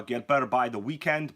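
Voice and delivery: normal talking voice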